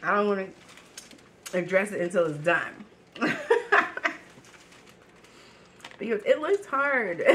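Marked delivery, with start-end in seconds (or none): 3.1-4.6 high-pitched nervous giggle; high laugh. Real, not acted. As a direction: burst into a squeaky laugh. Nervous laugh about challenge.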